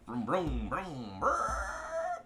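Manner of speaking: playful vroom of a revving car; engine noises. Retold or imitated. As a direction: make the engine sound like a kid. Mimics his tank speeding off.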